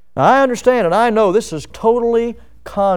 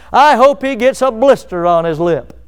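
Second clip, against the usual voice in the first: higher, louder petty curse; spiteful mock wish; 3 semitones higher, 4 dB louder than usual. Preacher imitates someone cursing an enemy, higher and louder.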